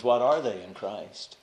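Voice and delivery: ordinary talk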